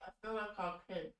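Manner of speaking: talking with food in mouth; words round a mouthful; mouth full. Real, not acted. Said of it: "speaking to me with food in your mouth".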